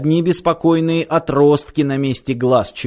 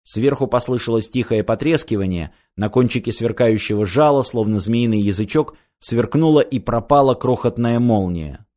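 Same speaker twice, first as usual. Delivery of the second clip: ordinary delivery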